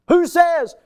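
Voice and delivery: very high, loud "Who says?"; sudden cry. Real, not acted. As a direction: fling the question at the room. Rhetorical challenge.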